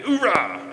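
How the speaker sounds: high, loud mock oorah; imitated Marine grunt. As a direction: punch out a happy Marine cheer. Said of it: "favorite grunting noises is going".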